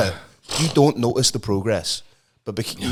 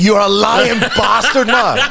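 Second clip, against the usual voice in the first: high, loud outrage through laughter; hammed-up indignant shout, drawn out; mock raging; 7 semitones higher, 5 dB louder, slower than usual. Son calling out his mate's lie, mock raging. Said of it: "He was fucking raging".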